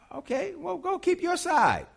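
high, airy brush-off; mock dismissive quip; high sarcastic retort. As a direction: wave them off in a high voice.

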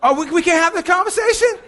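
high and loud teasing protest; mock indignant. Real, not acted. Preacher teasing congregation about tithes, high loud mock protest.

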